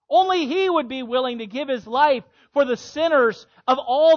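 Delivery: loud, rising preacher's crescendo; preaching at full force. Acted. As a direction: drive each phrase harder.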